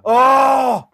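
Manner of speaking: sharp high "oh"; excited shout. Real, not acted. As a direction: cry out at the near miss. Chance missed, live reaction.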